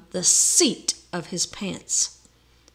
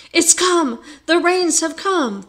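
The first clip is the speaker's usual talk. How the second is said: high, loud sudden alarm; excited cry; 8 semitones higher, 7 dB louder than usual. A narrator's reading. Carpenter in his barrel crying out the flood has come. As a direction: burst out as if the flood is here.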